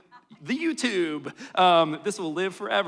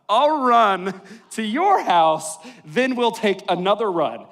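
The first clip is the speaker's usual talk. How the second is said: laughing quote; 5 semitones higher, 8 dB louder than usual. Retold or imitated. Quoting Deanna's cheerful offer.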